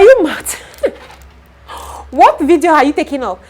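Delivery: a loud, high "you mad?"; outraged retort. Retold or imitated. Snapping back at the workman who asks for pay, in a loud, high outrage. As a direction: fire it straight back at him in disbelief.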